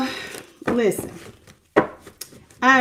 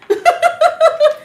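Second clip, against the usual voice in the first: high delighted laugh; bright giggle at seeing the viewers; 3 dB louder than usual. Real, not acted. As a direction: burst out laughing with glee. Greeting viewers joining the live.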